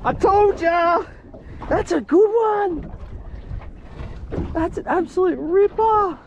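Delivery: high, slow, thrilled cheering; excited celebration. Real, not acted. Angler crows to her partner about her catch, thrilled. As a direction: savour the win, drawn out and high.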